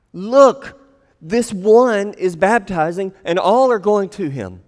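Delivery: loud, high indignant report; angry complaint. Acted. Disciples complaining to John the Baptist, indignant. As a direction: build to a resentful "Look". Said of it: "let's put it together".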